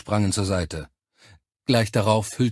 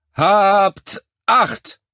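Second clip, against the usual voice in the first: loud, high, drawn-out parade-ground shout; barked military command; 11 semitones higher, 8 dB louder than usual. A narrator's reading. Officer calling the recruits to attention, shouted command. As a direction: call the troops to attention.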